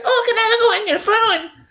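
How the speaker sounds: chirpy high mock kid asking; mock child voice. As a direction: play the pushy kid in a squeaky voice. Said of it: "This kid said".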